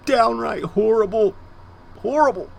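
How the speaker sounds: high, loud overwrought dismay; theatrical lament. Pretend. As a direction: lament it loudly, pitched high, overdramatic. Lamenting the cat's death.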